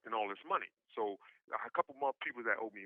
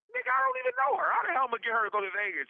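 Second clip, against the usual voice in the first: much higher, louder, then rushing the question fast; incredulous outburst; 8 dB louder, faster than usual. Retold or imitated. Narrator snapping back at his friend, loud, high and incredulous. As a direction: explode in disbelief and race through the rest.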